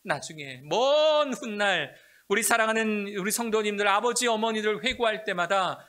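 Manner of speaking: high, loud, rising sermon cry; preaching at full force. Real, not acted. Pastor exhorting parents about children, full-force preaching. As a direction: build the preacher's fervor.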